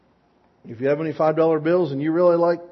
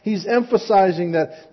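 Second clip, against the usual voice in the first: usual speaking voice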